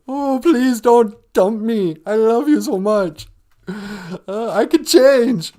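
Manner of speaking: high, slow, whiny begging; mock pleading crybaby. Guy imitating his younger self begging girlfriend tearfully. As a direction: ham up a dumped guy begging through tears. Acting a crying dumped guy.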